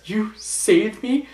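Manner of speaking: high, slow, amazed question; surprised Robin. Retold or imitated. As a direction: Robin marvelling that he was caught. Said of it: "Robin's like this".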